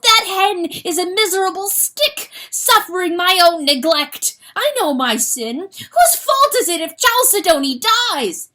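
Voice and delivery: loud, high self-accusing cry; anguished outburst. Acted. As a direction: rage at himself in despair. Rooster self-blaming outburst.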